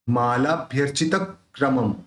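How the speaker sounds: मालाभ्यर्चितक्रमम split as "chitak-kramam"; आधा क जोड़ कर. Shown as a demonstration. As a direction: close on k after चित, then say क्रमम. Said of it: "आधे क को त के साथ जोड़". (मालाभ्यर्चितक्रमम में त के साथ आधा क)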